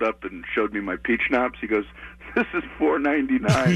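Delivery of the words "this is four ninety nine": higher-pitched retold complaint; mock indignation. Backstage guy showing comic the cheap schnapps, mock indignant. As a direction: imitate an affronted backstage runner.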